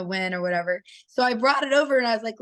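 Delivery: usual speaking voice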